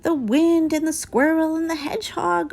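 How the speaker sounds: desperate high calling; frenzied cry. A narrator's reading. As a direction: panic as everyone takes the leaves. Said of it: "cried a frenzied Fletcher".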